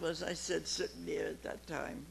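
normal talking voice

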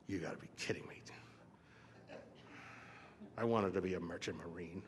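low, softened grumble with long pauses; envious resentment. Retold or imitated. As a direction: sulk it quietly under your voice. Envious reaction to captain, acted.

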